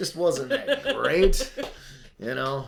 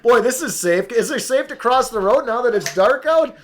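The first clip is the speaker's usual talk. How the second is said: sarcastic; 6 semitones higher, 6 dB louder than usual. Retold or imitated. Sarcastic about masks.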